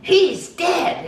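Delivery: loud, very high mimicked wail; retold outcry. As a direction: imitate a man crying out the bad news. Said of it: "And he goes".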